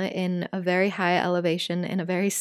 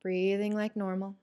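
calm breathwork teacher voice, softer; guided meditation; 7 dB quieter than usual. Acted. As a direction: ease them gently out of the breath hold. Leads a guided breathing practice.

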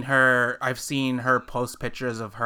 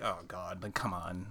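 exasperated aside; 6 semitones lower, 13 dB quieter than usual. Retold or imitated. Acting the exasperated onlooker reaction.

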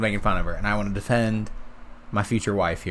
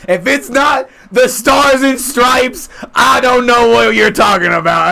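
much higher, loud, slow patriotic yell; proud American shout; 14 semitones higher, 12 dB louder, slower than usual. Retold or imitated. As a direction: belt it like a proud flag-waving patriot. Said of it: "She said".

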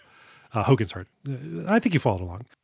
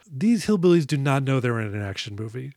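usual speaking voice

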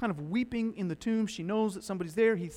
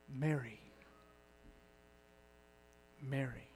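tender calling; 6 semitones lower, 13 dB quieter than usual. Acted. Risen Jesus gently calling weeping Mary, tender hush. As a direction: say her name like a comfort.

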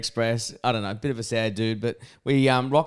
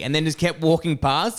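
ordinary talk, nothing marked

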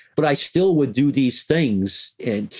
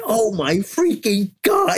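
slow and much higher, stunned; awed disbelief; 10 semitones higher, slower than usual. Retold or imitated. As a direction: draw it out in wide-eyed amazement. Colleagues whispering in awe.

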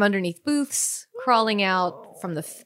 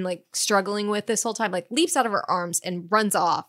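ordinary delivery

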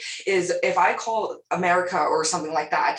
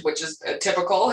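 ordinary delivery

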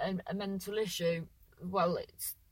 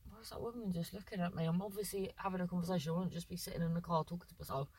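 muttered aside; 3 semitones lower, 9 dB quieter, much faster than usual. Real, not acted. Muttered aside about staring woman.

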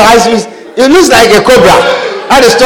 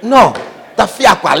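ordinary talk, nothing marked

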